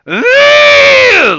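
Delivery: loud, high-pitched frustrated growl-scream; frustrated yell. Retold or imitated. Dad imitates Max losing at his Switch game, frustrated yell. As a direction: imitate a kid's exasperated roar. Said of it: "like he's really frustrated".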